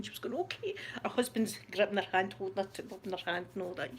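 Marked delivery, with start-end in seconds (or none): 0.3-0.7 tiny high whisper; mock-meek, barely audible reply; timid acceptance. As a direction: squeak it out softly. Nervous woman answers police.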